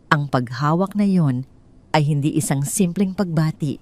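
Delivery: usual speaking voice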